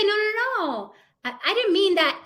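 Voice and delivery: high-pitched backpedalling protest; protesting denial. Acted. Speaker mimics someone denying what they said, high protest.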